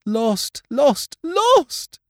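high, climbing wail of despair; despairing cry. A narrator's reading. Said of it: "said Ruth to herself".

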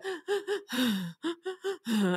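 high, soft catching-breath gasps; gentle mock sob-gasp; calming gasps. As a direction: mimic a child gulping air to calm down. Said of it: "they kinda do the".

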